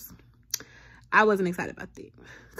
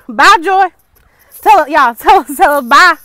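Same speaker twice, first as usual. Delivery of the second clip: calling out; 5 semitones higher, 17 dB louder than usual. Real, not acted. Mock goodbye while Joy walks off.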